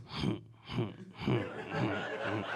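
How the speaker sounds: soft low breathy evil chuckle; evil laughter. Retold or imitated. Son chuckling at his dad, soft and evil. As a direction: cackle quietly like a villain. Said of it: "just evil laughter".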